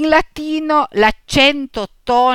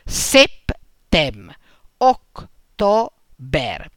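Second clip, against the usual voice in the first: septem, october broken between the consonants; sep, tem; split into syllables; 4 semitones lower, much slower than usual. Shown as a demonstration. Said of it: "l'ultima consonante appartiene alla sillaba seguente".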